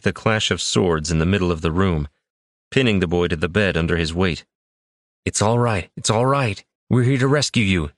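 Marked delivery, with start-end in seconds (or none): 5.3-7.9 frantic babbling. A narrator's reading. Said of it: "he babbled in a frantic effort".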